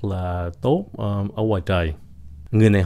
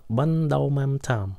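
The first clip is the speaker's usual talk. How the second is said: bún đậu mắm tôm in a slow, higher American accent; 5 semitones higher, 3 dB quieter, slower than usual. Shown as a demonstration. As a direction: put on an American accent for the dish name. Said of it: "Người Mỹ phát âm cái chữ bún đậu mắm tôm vậy đó". (giọng Mỹ đọc "bún đậu mắm tôm")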